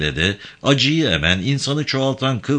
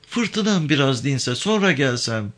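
pleading woman; 6 semitones higher than usual. Acted. Avke asking Yavan for time, pleading woman.

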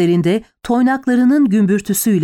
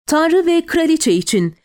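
raised-pitch rallying cry, restrained volume; roared battle cry; 7 semitones higher than usual. A narrator's reading. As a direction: cry it out after the riders, narrated. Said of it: "diye gürledim arkasından".

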